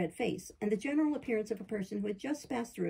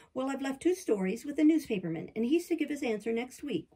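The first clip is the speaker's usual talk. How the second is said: ordinary delivery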